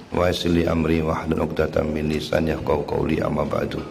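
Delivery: ordinary talk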